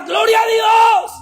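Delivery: high, loud, slow cry of praise; shouting praise. Retold or imitated. Pastor retelling a sister crying out praise, shouted slowly. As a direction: cry it out like a freed woman. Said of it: "la hermana gritaba".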